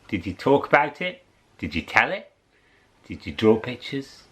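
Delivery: ordinary delivery, nothing marked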